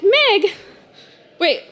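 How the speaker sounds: a hint of a mother catching on; startled mom. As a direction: mom stops her daughter, surprised. Mom finds the cupboard empty.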